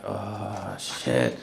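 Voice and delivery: low, soft, sinking realization; dazed dread. Retold or imitated. Patient processing overdose news with eyes closed, dazed and hushed. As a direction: let it sink out quietly, very low. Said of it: "literally was just eyes closed".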